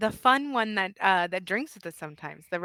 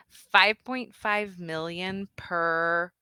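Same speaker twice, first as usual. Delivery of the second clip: slow, deliberate; slower than usual. Acted. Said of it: "lean out and be like".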